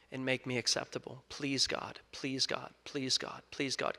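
soft, desperate repeated please; quiet pleading. Retold or imitated. Student on the floor begging God, softly pleading. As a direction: plead under your breath to God.

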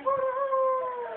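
high, slow drawn-out hooty hoo call; animal call. Acted. Calling out across the mountain for the animals. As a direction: stretch the call out high like a bird.

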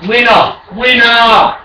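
ordinary talk

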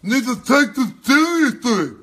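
high, loud put-on voice parroting the guest; mocking parody voice. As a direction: whine it like a prissy pundit.

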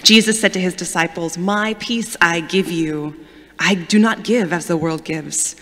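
ordinary talk, nothing marked

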